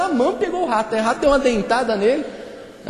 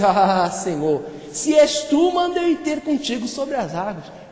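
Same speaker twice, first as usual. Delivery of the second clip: hammed-up dare, voice climbing; provoking challenge; slower than usual. Peter dares Jesus to call him onto the water, theatrical challenge. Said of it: "Aí Pedro foi provocar".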